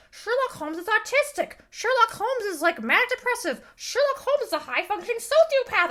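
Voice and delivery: high-pitched mocking chorus of opinion; mock everyone. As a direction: parrot a popular take in a high, silly voice. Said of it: "everyone's like".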